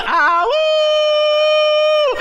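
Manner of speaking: high loud ah woo; imitating uncle's shout. Acting out the uncle's gift shout, loud and high. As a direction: whoop like Uncle Harry. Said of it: "He's just".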